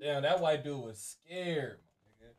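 quiet aside. Real, not acted. Aside after the prank call.

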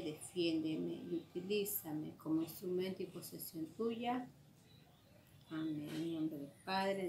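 soft, low, even recitation; low devotional murmur in a steady rhythm; recited prayer. Real, not acted. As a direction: pray it by heart, quietly and evenly.

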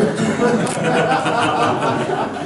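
breathy high laugh at his own joke; laughing at the office's habit; amused laugh. Real, not acted. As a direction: burst out laughing.

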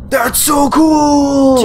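high, loud stunned delight; excited gasp. Real, not acted. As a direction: gasp then shout in awe. Monster transforms.

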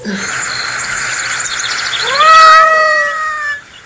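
long high shrieking laugh. Real, not acted. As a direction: cackle way up high. Wild laugh outburst.

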